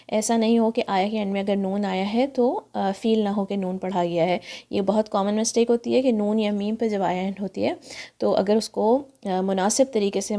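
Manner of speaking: normal talking voice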